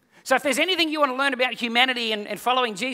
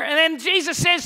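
ordinary talk

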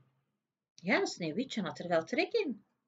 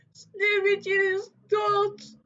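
high, slow, louder wailing grief; sobbing dwarfs; 12 semitones higher, 6 dB louder, slower than usual. A narrator's reading. Dwarfs tell the prince, high wailing sob. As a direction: wail it like heartbroken little men. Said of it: "snikten de bedroefde dwergen".

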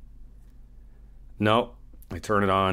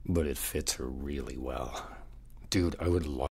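much softer and lower, half to himself; hushed confession; 5 semitones lower, 8 dB quieter than usual. Acted. Admitting to his friend he fancies the desk girl, hushed. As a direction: drop your voice so nobody hears.

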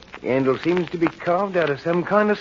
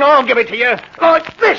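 high, loud shout; murderous fury; 8 semitones higher, 6 dB louder than usual. Acted. Henry lunging at Millie with the knife, furious shout. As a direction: build to a shout as you strike. Stabs his wife in rage.

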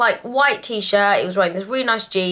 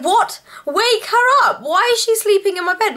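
much higher-pitched, incredulous questioning; shocked outburst; 12 semitones higher than usual. Retold or imitated. Daughter to mum about friend in her bed, shocked. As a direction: react in high-pitched disbelief to the news. Retold shocked reaction to mum's news.

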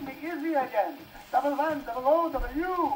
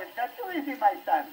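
normal talking voice

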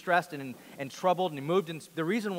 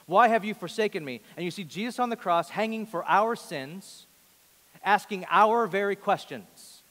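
louder and higher, pressing the question home; preaching at full force; 4 semitones higher, 5 dB louder than usual. Acted. Pastor urging the congregation to own Jesus' cry, impassioned preaching. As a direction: build the sermon to its peak.

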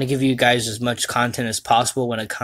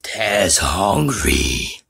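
very slow, growly Taz grunt; slow, beastly cartoon devil demanding food; Tasmanian Devil voice; 4 semitones lower, much slower than usual. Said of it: "that was Taz".

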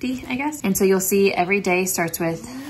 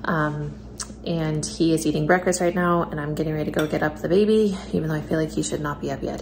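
ordinary delivery

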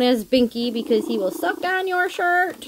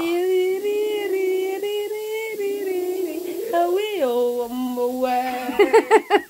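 long, high, giggling coo at the cat; high giggly coo; 7 semitones higher than usual. Real, not acted. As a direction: giggle and coo in one breath. Cooing at cats, long laugh.

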